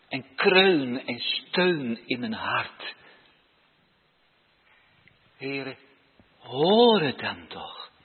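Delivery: slow, earnest plea to God; pleading prayer. Shown as a demonstration. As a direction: beg God to listen. (smekend, langzaam gebed)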